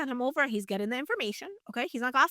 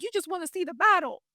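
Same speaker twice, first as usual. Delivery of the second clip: higher-pitched mock sibling taunt; lightly hammed-up accusing older brother; mocking accusation; 6 semitones higher, 4 dB louder than usual. Older brother accusing David of battle-watching, mock taunting.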